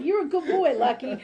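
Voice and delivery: high, loud, laughing praise of the dog. Real, not acted. Praising her dog during a reading, in laughing pet talk.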